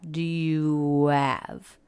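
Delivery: "Do you have?" drawn out, every word clear; slow, overly careful. Shown as a demonstration. Teacher showing the wrong, too-careful version. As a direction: say each word slowly and fully.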